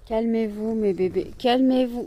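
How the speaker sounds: loud, laughing plea to the dogs; calm the pets down, loudly; loud pleading. Real, not acted. Owner to her jumping dogs, loud pleading.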